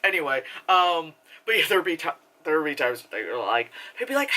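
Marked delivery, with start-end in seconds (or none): none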